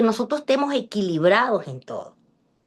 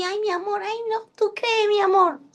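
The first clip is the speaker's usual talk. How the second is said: mock weak man; 9 semitones higher than usual. Teacher mocking a timid husband, pleading high voice. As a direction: mimic a man with too little testosterone.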